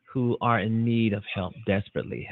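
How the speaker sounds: normal talking voice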